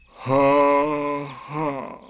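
slow mirthless mock laugh; sour, drawn-out sarcastic laugh; bitter. A narrator's reading. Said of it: "said Eeyore bitterly".

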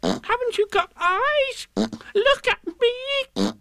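very high, drawn-out shriek; shouted squeal. A narrator's reading. Piglet demanding Kanga look at him, squeaky shout. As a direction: squeal slowly, stretching the last words. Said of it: "shouted Piglet".

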